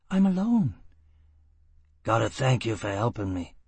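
a hint of rough gutter talk; gruff sailor.

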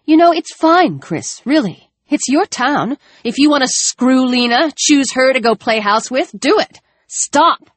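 rising shout, bitter and biting; jealous outburst. Acted. Alex lashing out at Chris over Lena in a rising, bitter shout.

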